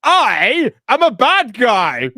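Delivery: high, slow hammy villain voice; mustache twirling villain. Retold or imitated. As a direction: ham up a cartoon villain. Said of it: "given a character along these lines".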